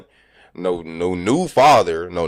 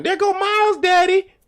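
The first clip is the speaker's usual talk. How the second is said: high, excited put-on voice calling out; coworkers calling out; 3 dB louder than usual. Coworkers spotting his son on screen, calling out in a high excited voice. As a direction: squeal it like you've just spotted someone famous.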